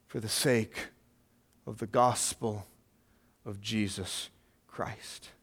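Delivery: low and soft, drawing the room in; hushed intense preaching. Acted. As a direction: let the words sink in quietly. Quiet close of searching question.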